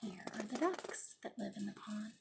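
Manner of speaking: hushed whispered aside; whispered picture aside. Real, not acted. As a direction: breathe it out like a secret. Quiet aside while showing pictures.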